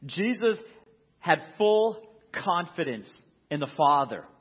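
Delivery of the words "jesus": ordinary talk, nothing marked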